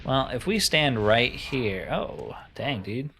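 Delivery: ordinary delivery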